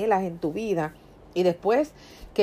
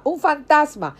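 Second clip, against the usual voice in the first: repeated high, louder fearful cry; frightened shout; 7 semitones higher, 4 dB louder than usual. Acted. Disciples in the boat crying out in fear. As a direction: shout "¡Un fantasma!" again, still pitched up.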